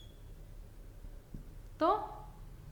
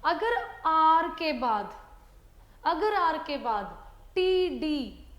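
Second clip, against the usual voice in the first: slow emphasis; much slower than usual. Shown as a demonstration. Teacher stressing fourth rule to children. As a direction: lean on each word so they listen. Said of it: "ध्यान से सुनिएगा बच्चों".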